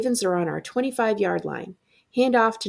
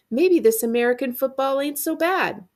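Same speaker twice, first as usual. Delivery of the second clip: retold shout; 7 semitones higher, 3 dB louder than usual. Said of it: "I hear Luis shout".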